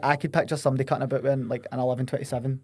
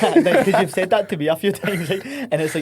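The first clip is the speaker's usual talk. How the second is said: laughing; 5 semitones higher, 4 dB louder than usual. Real, not acted. Laughs while teasing his friend.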